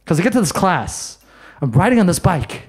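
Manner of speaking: ordinary talk, nothing marked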